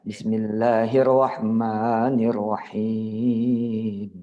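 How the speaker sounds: drawn-out devout basmala; slow recitation. Acted. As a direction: stretch each syllable like chanting in prayer. (melantunkan basmala dengan sangat pelan)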